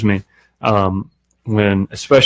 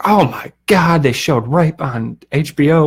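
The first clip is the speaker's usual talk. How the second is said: mocking outrage; 5 semitones higher than usual.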